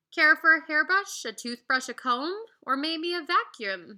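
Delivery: lightly voiced pushy salesman; eager salesman. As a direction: pitch the wares eagerly. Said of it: "said salesman Jerome".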